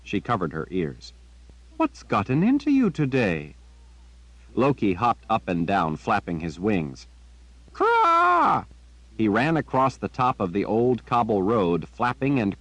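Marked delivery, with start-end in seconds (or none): 1.8-3.6 put-on girl's voice, a touch higher and puzzled; Jillian, puzzled. A narrator's reading. Girl asking her raven, lightly voiced puzzlement. 7.7-8.7 high, loud piercing caw; raven caw. A narrator's reading. Raven cawing to make Jillian follow.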